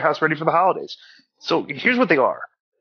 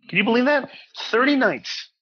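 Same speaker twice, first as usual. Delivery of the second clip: high, eager ad-read hype; excited; 8 semitones higher than usual. Acted. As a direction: sell the deal with amazement. Ad read, hyping the free trial.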